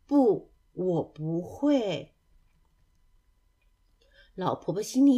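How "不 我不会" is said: drawn-out, halting answer; slow, dejected admission. Acted. Duckling answering the animals' challenge, slow and dejected. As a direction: stretch each word slowly, reluctantly. Duckling admits it can do neither.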